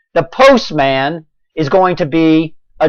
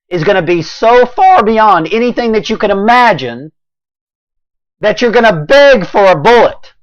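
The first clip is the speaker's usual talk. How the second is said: raised, high-pitched urgent voice; impassioned warning; 6 semitones higher, 4 dB louder than usual. Real, not acted. Warning governors they will regret inaction, voice raised in passion. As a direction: build to a loud, high warning.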